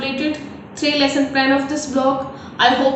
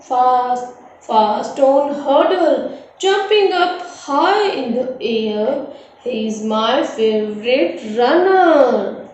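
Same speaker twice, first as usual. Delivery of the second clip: slow, drawn-out singsong recital; chanted classroom verse, slow and measured; poem recitation; slower than usual. Shown as a demonstration. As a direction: recite it rhythmically, stretching the words. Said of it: "I'm going to recite it".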